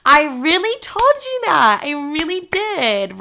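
high, slow mock-innocent voice of the ex. Girlfriend mocking her ex's fake surprise, high and drawn out. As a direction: stretch it out high like a clueless boyfriend.

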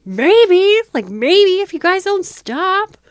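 high, loud, slow snapped answer; angry retort. Retold or imitated. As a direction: throw the threat back at him, loud and high. Answering son's question in anger.

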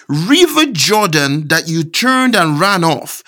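high, impassioned sermon delivery building in pitch; fervent preaching. Real, not acted. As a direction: preach the scripture with mounting fervour, leaning hard on key words. Preaches scripture with rising force.

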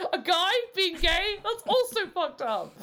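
high-pitched pretend scandal; hammed-up shock, high and emphatic; mock outrage. Mock outrage at crazy teens.

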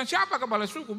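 put-on high, loud Papuan-accented question; imitated Papuan accent. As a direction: ask it loudly in Papuan lilt. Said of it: "Saya pernah ke Papua".

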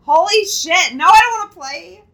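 loud high frightened yell; shouting. Real, not acted. Reacting to scary toy story.

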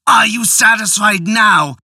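angry taunt. Acted. Husband taunts drowned wife.

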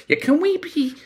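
high, mock-prim headmaster voice; a squeaky send-up of a fussy headmaster; imitating the headmaster. Guest mocks the headmaster planning the school's story, high mock voice.

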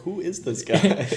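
high, slow, laughing mock-confused voice; lightly voiced confused homeowner, laughing; imitating baffled homeowner. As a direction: play the puzzled guy on the phone. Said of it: "he's probably like".

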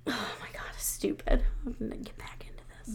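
breathy whisper, off to the side; whispered aside. Real, not acted. Friend whispers a self-scolding aside, clearly whispered. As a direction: whisper it to yourself, half turned away. Aside muttered away from mic.

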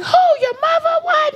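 very high, frantic questions; grandma fearing the worst, shrill panic; panicked grandmother. Retold or imitated. Grandmother thinking the mom died, frantic and high. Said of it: "my grandmother was like".

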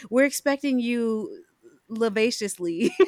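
slowed, hunting for the English word; slow, searching for words. Real, not acted. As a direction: drag it out while translating in your head. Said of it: "how do you say this in English".

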